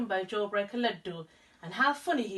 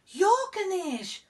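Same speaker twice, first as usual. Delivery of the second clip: higher, louder, slow amazed cry; exclaimed; 10 semitones higher, 7 dB louder, slower than usual. Acted. Old man amazed to meet Ganesh, slow and loud. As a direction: stretch it out in wonder. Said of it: "the old man exclaimed".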